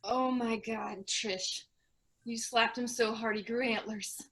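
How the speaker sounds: soft, appalled aside; hushed shock. Acted. Onlooker blames Trish for the antlers, in hushed shock. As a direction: say it under your voice, appalled. Shocked reaction to Johnson's state.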